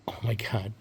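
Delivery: whispered aside; soft whisper of disbelief; hushed amazement. Acted. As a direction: breathe it out under your breath, amazed. Aside on finding the caps.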